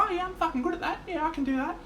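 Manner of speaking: very high, excited mate's impression; high-pitched goofy mate voice; the Blythe voice. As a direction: squeak it out like a clueless, delighted bloke. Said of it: "The Blythe voice".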